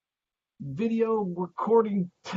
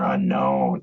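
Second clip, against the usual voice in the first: much lower, slow announcer boom; deep dramatic voice; 7 dB louder than usual. Pretend. Dramatic naming of mystery man.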